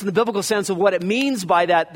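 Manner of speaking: ordinary delivery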